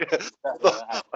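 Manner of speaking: high laughing talk. Real, not acted. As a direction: say it through laughter. Laughing while talking.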